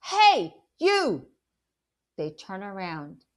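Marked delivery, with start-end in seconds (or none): 0.0-1.3 loud, high shout across the street; yelling. Acted. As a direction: shout to stop the thieves. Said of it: "I yell".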